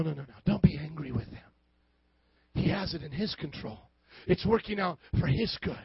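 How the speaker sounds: ordinary delivery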